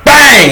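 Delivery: high, loud spoken bang; mock gunshot. Cop shooting the infected, loud mock bang. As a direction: shout bang like a kid's gunshot. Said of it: "then goes".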